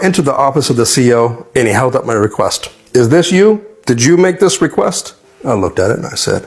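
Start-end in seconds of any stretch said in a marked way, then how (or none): 2.9-5.1 imitated officer, pitched up and incredulous; questioning officer. Commanding officer holding up a prisoner's request, imitated challenge.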